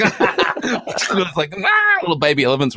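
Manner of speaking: high, slow, laughing through it; joking about the elephant film scene, laughing; laughing mock. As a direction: giggle out the silly image.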